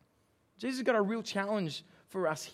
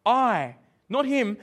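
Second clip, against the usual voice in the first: "I" hit much louder, "not him" higher and louder; emphatic I; 3 semitones higher, 9 dB louder, much slower than usual. Shown as a demonstration. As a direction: stress "I" hard against "him". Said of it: "It's emphatic".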